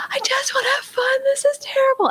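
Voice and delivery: put-on very high, thin kid's wail; screaming child imitation. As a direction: mimic an upset child in a high squeal. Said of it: "then he started to scream".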